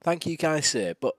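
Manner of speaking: polite and proper, a touch of upper-class English; posh voice. Acted. Amnesiac young man thanking the inspector, lightly posh. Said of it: "a posh voice".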